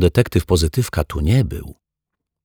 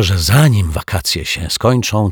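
ordinary delivery, nothing marked

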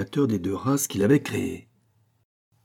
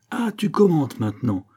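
higher, taunting jeer; mocking; 7 semitones higher, 4 dB louder than usual. A narrator's reading. Priest tries to unsettle Pitou, mockingly. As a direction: jeer to throw the opponent off. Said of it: "démonter Pitou par ses railleries".

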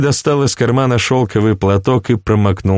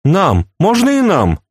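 excited clamour; 11 semitones higher than usual. A narrator's reading. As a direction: clamour for a turn like greedy children. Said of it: "заговорили вокруг".

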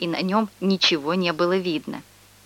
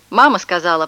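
usual speaking voice

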